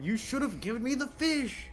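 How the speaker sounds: lightly voiced old man; a hint of a creaky old man. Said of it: "in the old man voice".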